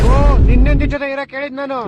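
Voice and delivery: doctor shouting at his confused patient; exasperated shout. Acted. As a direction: yell it out in disbelief. Doctor irritated, correcting patient.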